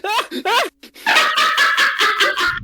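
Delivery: high-pitched mock screams of pain; short, high yelps of a burning cultist; hammed-up squealing screams; screaming.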